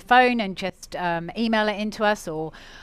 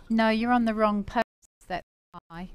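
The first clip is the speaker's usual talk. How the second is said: ordinary talk, nothing marked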